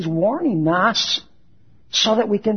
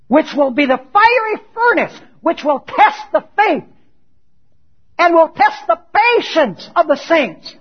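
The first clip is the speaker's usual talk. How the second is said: loud, raised preacher's voice; forceful preaching; 8 semitones higher, 6 dB louder than usual. Acted. Preacher warning the congregation of the fiery furnace, forceful. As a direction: punch out each phrase from the pulpit.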